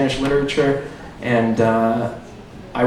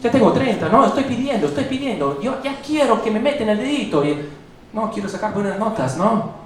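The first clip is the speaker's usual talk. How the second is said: higher, hammed-up Spanish stand-up persona; alter ego Nicolas; 6 semitones higher than usual. Comic in his alter ego greets the crowd as Nicolas. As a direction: play the cocky gringo comic Nicolas. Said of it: "Back to Nicolas".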